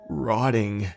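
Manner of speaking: ordinary delivery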